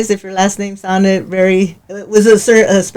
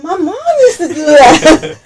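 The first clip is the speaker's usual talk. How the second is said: laughing; 7 semitones higher than usual. Real, not acted. Laughing recalling her mom's remedy.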